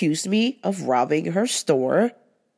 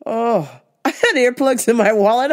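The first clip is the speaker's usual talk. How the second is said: ordinary talk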